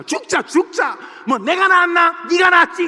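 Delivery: high, loud bickering shout; quarrel. Retold or imitated. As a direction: yell like a parent fighting with a grown child. Parent and child quarrel acted.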